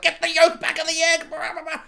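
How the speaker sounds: high, loud nerdy shout; squeaky nerd voice yelling the fix; mock nerd. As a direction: play a flustered nerd barking orders. Nerd character giving orders.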